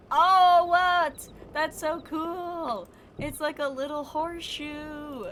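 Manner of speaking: thrilled by a little find; excited. Real, not acted. Finds a cool item.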